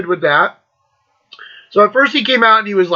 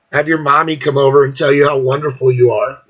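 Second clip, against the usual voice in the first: laughing; 7 semitones lower than usual. Real, not acted. Mocking him, breaking into laughter.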